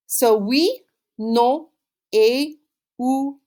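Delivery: ou, aussi drawn out very slowly; slow model. Shown as a demonstration. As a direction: stretch ou and aussi for repetition. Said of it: "Say all five like that after me".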